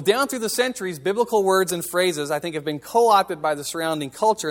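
ordinary talk, nothing marked

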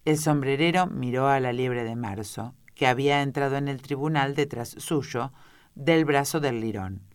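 plain reading voice

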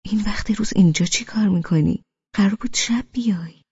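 plain reading voice

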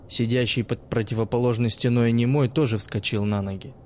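plain reading voice

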